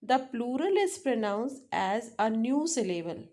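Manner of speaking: ordinary talk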